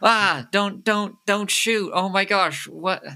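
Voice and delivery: high-pitched panicked pleading; hammed-up fright, begging for mercy; startled yelling. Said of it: "They yell. They're like".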